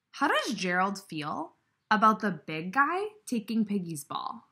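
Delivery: ordinary delivery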